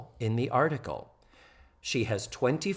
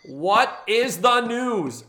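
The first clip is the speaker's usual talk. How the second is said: high, loud, slow exasperated demand; Natalie; 9 semitones higher, 7 dB louder, much slower than usual. Retold or imitated. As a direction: hit each word hard, dragged out in impatience. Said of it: "And Natalie's like".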